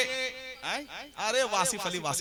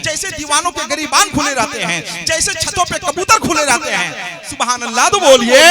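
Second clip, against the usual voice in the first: from firm to much louder, higher peak; building oratory; 4 semitones higher, 14 dB louder than usual. Acted. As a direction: swell each phrase toward the cheer. Building oratory praise, crescendo. (धीरे-धीरे ऊँची होती जोशीली तक़रीर)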